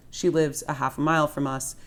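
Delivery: ordinary delivery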